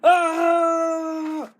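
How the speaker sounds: high shouted screams; terrified screams. Pretend. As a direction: shriek as the dinosaur grabs you. Figures caught by the T-Rex.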